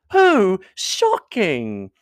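a hammed-up gasp of outrage; mock shock. A narrator's reading. Miss Bingley to Darcy, affecting outrage, slow mock shock. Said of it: "cried Miss Bingley".

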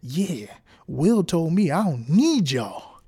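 mock cocky quitter. Worker quitting on the boss, slow smug gloat. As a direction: rub it in the boss's face, slowly. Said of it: "Don't go up in there tomorrow and be like".

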